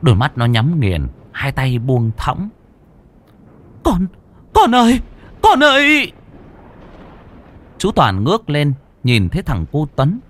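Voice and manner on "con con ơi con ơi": put-on high, loud desperate calling for her child; mother crying out for her floating son; frantic cry. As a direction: scream out for the son in terror. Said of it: "Cô Liên rùng mình".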